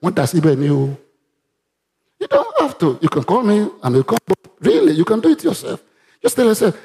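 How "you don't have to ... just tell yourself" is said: high, fervent pulpit voice; fervent exhortation; preaching. Real, not acted. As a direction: press the point to the church. Preaching at full force.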